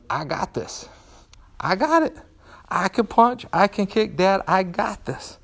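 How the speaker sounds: high-pitched imitation of a confident kid; young son's voice. Son assuring dad at the kitchen table, high kid voice. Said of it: "his answer was".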